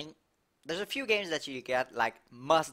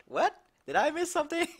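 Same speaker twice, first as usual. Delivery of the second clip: surprised. Real, not acted. Surprised at chat comment.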